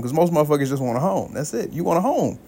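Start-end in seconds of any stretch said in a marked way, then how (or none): none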